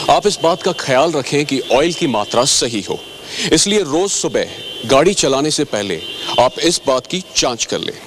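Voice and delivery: ordinary talk, nothing marked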